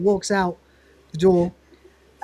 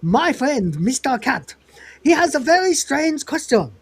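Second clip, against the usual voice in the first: Taiwan Tony; 6 semitones higher than usual. Retold or imitated. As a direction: put on a hammed-up Taiwanese accent, rising in pitch.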